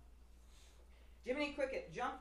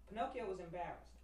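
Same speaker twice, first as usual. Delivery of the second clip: plain reading voice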